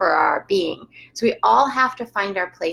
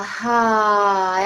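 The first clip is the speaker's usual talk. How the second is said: audible exhale. Shown as a demonstration. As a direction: demo out-breath. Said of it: "as you exhale".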